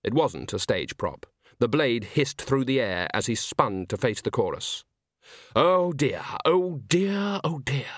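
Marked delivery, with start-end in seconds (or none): 5.5-7.9 mock dismay.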